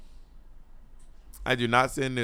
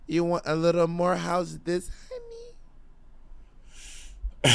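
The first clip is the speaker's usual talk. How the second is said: bad Lady Bunny impression; 3 semitones higher, 7 dB quieter than usual. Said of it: "that is a horrible Lady Bunny impression".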